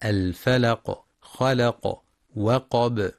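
الفلق، خلق، وقب with echoed final consonants; qalqalah on stopping. Shown as a demonstration. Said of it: "وينتبه القارئ للقلقلة عند الوقف".

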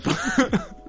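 high laughing protest, last words slowed. Real, not acted. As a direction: drag out Sherlock Holmes through laughter.

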